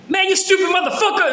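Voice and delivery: laughing while imitating the screaming guard; mock yell. Retelling how the officer yelled at him, laughing mock shout. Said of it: "everyone's hearing him talking like that".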